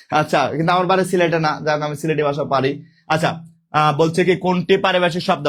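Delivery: ordinary talk, nothing marked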